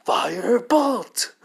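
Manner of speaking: high, drawn-out full shout; yelled battle cry. Acted. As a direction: yell it long and loud like a spell. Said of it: "and he yells out".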